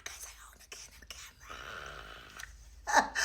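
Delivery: very soft, breathless whisper; awed whisper. Real, not acted. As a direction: whisper it in disbelief.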